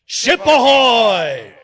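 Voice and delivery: very loud, long, high hail; group pirate shout. Pretend. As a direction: bellow it slowly across the water. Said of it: "Let's try it all together".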